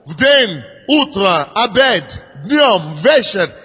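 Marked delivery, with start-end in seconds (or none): none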